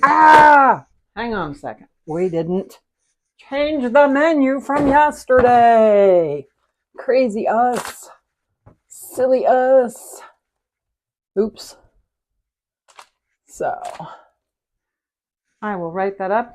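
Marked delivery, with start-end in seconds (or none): 0.0-0.8 high, loud "ah" held out; startled cry. Real, not acted. Cook realizing a slip on camera, startled cry. Mock scream at a realized mistake. 3.4-11.8 high, drawn-out, singsong lament; playful self-mockery. Real, not acted. Cook owning up to not changing the menu, playful self-mockery. Playfully scolding herself for forgetting.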